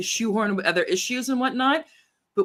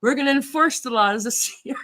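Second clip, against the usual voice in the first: laughing mock announcement; 5 semitones higher than usual. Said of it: "So I was like".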